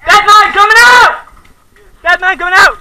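very loud high shout; dead man call. Real, not acted. Leaving field after being hit.

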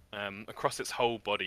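normal talking voice

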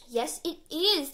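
slow, loud, drawn-out insistence; stubborn friend insisting on his joke. Acted. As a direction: dig in and stretch every word. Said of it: "Terry insists".

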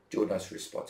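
normal talking voice

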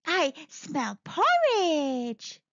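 slow, savouring sniff. Acted. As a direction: draw it out like smelling food. Goldilocks sniffs the air.

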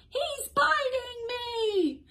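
very high, drawn-out storybook squeal; long high-pitched mock wail; piggy squeal. A narrator's reading. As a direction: squeal it slowly, far above normal voice. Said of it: "Piggy squealed".

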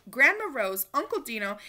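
ordinary delivery

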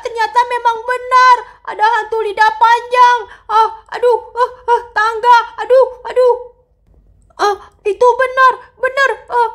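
high, loud terrified yelling; boy fleeing the ghost, screaming high and loud; panicked scream. Acted. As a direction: shriek in panic, stumbling away. Yuto sees ghost, falls on stairs.

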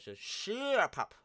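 high, loud, slow teasing shut up; drawn-out high shut up. Shown as a demonstration. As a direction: stretch it out high and loud like a squeal. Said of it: "so put these sort of put".

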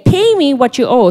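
mock demand. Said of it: "took him by the throat".

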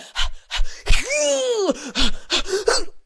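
high, drawn-out straining squeal with panting; straining push. Retold or imitated. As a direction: mimic pushing hard, squealing and gasping.

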